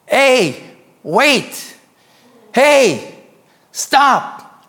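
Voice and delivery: loud, high calls from afar; short urgent shouts, acted out; imitated yelling. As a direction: holler to stop someone walking away. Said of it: "I thought it was the doctors yelling at me".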